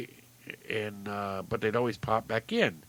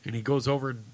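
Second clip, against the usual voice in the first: ordinary talk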